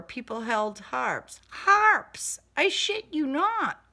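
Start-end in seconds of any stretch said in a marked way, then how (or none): 1.5-2.4 "Harps!" flung out high and loud in disbelief; a sudden high, loud "Harps!"; incredulous exclaim. A narrator's reading. Incredulous repeat of odd detail. 2.5-3.8 high, loud insistence; higher and louder, wide-eyed; emphatic disbelief. A narrator's reading. Insisting on absurd detail.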